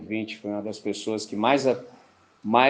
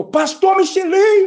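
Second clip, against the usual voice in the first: high, loud drawn-out yell of a name; retold street shout; 9 dB louder, slower than usual. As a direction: call it out across the street, stretched. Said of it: "o cara já gritou". (grito alto e arrastado chamando o pastor)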